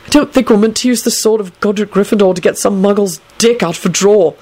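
ordinary delivery, nothing marked

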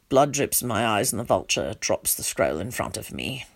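low, soft, numb telling; subdued story narration. A narrator's reading. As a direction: narrate it hushed and low, as if in shock. Numb narrator tells of vulture.

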